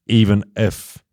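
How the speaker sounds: ordinary talk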